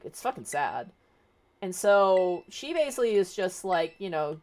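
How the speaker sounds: ordinary talk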